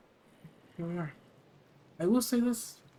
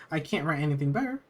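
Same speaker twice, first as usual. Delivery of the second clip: usual speaking voice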